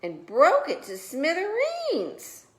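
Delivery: high, slow little bear lament; Baby Bear. A narrator's reading. Baby Bear finding his chair broken, high slow lament. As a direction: drag out the complaint. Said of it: "said Baby Bear".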